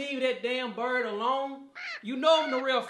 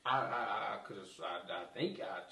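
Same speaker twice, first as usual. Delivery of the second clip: guilty stammer; 7 dB quieter than usual. Acted. Realizes he killed bird.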